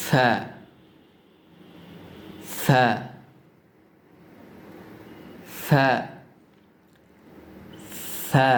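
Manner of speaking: সা with tongue tip on the upper front teeth as th; Arabic tha. Shown as a demonstration. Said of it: "খেয়াল করুন".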